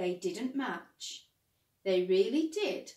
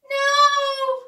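very high, loud terrified no; frightened yell; 16 dB louder than usual. Acted. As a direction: shriek it in panic. Simon yells in fright.

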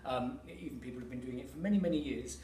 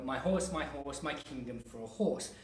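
horse and kingdom hit as the strong beats; stress on horse; 2 semitones higher, 4 dB louder than usual. Shown as a demonstration. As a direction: lean on horse each time. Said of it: "the strong stresses are indicating the words".

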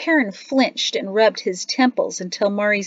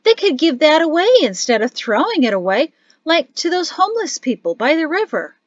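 high, loud protest at waste; child's incredulous appeal; indignant outburst; 10 semitones higher, 7 dB louder than usual. A narrator's reading. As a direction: object loudly, pitched right up. Child outraged at wasted grain.

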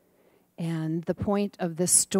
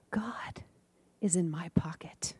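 put-on whispered, as a small boy telling a secret; hushed child's answer; 7 dB quieter than usual. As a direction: breathe it out like a little boy. Child's answer told softly.

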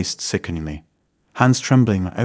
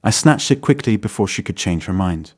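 ordinary delivery

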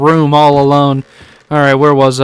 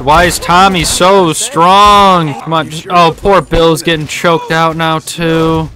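ordinary delivery

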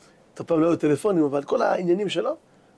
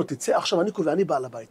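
normal talking voice

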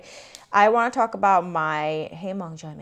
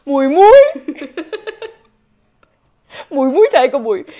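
loud playful; 5 semitones higher, 12 dB louder than usual. Pretend.

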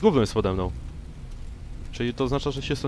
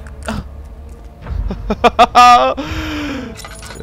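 high giddy laugh; 13 semitones higher, 9 dB louder than usual. Real, not acted. Laughs after chant.

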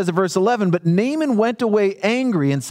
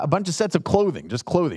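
ordinary talk, nothing marked

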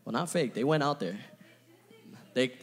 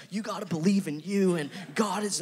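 mock TED talk speaker; 4 semitones higher than usual. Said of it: "I hate when sermons sound ted Talky".

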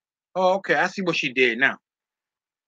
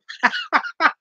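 real helpless laugh, way up high; shrieking giggle; high squealing laugh. Real, not acted. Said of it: "You got me laughing".

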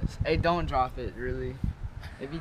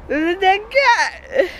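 shocked; 12 semitones higher, 6 dB louder, slower than usual. Real, not acted. Repeats dead cat, slower.

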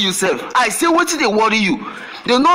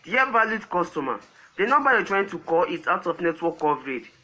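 korean call center politeness; 4 semitones lower, 9 dB quieter than usual. Acted. Automated network operator message.

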